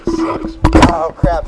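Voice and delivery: loud pained outburst; pained yell. Real, not acted. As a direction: cry out after a fall. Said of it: "what did that sound like".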